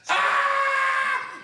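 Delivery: long, very high scream; loud holler. Retold or imitated. Teen hit in the stomach by police, hollering loud. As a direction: holler at the top of your voice. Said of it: "I hollered loud and well".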